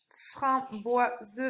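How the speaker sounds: "Framboise" split slowly as fram-boi-se; teacher's measured syllable split of "Framboise". Shown as a demonstration. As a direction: sound out "Framboise" in separate syllables. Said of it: "on entend feu sur la première".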